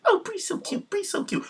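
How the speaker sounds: very high, cooing imitation; mock gushing. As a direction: parody a fawning fan in a high voice. Mocking gushing over Brie.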